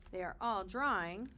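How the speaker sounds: usual speaking voice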